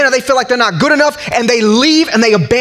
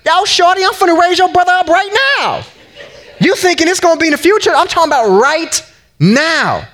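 high, laughing, casual slang voice; Jesus voiced playfully high, laughing; playful street-talk Jesus; 9 semitones higher than usual. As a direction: tease her, he's about to do it now.

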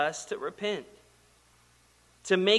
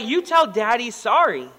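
lightly acted firm dad, louder and higher; stern parental order; 5 semitones higher, 5 dB louder than usual. Father to defiant three-year-old, stern parental order. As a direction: insist like a father demanding an apology.

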